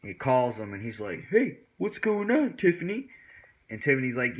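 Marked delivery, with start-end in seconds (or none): 1.3-3.1 imitated friend on phone. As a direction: mimic a buddy picking up. Retold phone greeting from friend.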